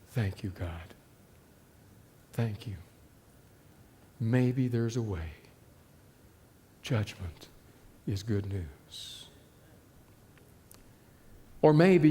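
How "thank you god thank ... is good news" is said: much softer, lower, hushed gratitude; quiet relief close to crying; tearful thanks. Retold or imitated. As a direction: murmur thanks through tears. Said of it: "tears run down her cheeks".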